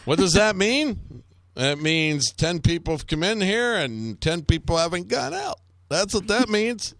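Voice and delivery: high, singsong mock explanation; hammed-up menacing patter; taunting interrogator. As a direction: toy with the prisoner, pitched high. Plays interrogator taunting a prisoner.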